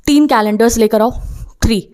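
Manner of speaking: ordinary talk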